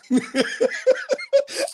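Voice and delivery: high, cackling burst of laughter; real laugh. Real, not acted. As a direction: crack up at your own story. Laughs at own story.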